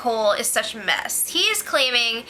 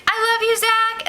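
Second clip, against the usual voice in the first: high shouted call from afar; mock fan shout; 10 semitones higher than usual. Imitating a girl fan calling to Zach, high mock shout. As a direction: squeal it over the wall. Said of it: "this girl was like".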